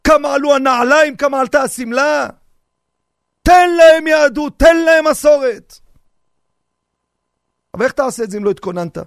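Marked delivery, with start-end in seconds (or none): 3.4-5.7 slow, loud, high exhortation; preaching shout. Acted. Rabbi urges grandfathers to give tradition, loud preaching cry. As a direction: drive the charge home to the grandfathers.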